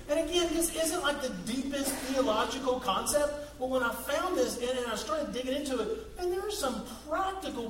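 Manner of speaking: ordinary talk, nothing marked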